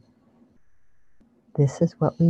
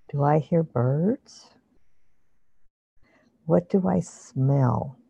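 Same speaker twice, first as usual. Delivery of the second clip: guided meditation. Acted. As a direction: ease them into calm imagery. Leads a guided visualization.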